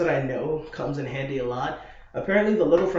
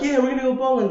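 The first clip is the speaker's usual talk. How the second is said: high, louder eager announcement; eager friends; 10 semitones higher, 5 dB louder, faster than usual. Retold or imitated. French friends planning the night out, high eager voice. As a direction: sound keen as the French friends.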